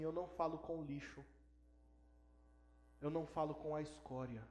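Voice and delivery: low, soft scornful dismissal; understated disdain; retold cold contempt. Father coldly rejects his son.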